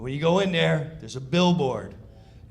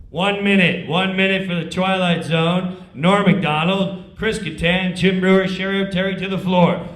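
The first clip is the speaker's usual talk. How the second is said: stage manager call; 8 dB louder than usual. Retold or imitated. Stage manager calling cast.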